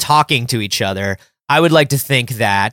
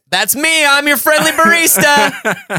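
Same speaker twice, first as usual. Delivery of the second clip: high, slow and laughing; friendly barista voice; 11 semitones higher, slower than usual. Pretend. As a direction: ham up a cheery barista. Barista voice introduces self.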